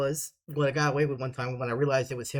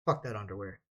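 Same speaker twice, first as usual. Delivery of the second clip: ordinary delivery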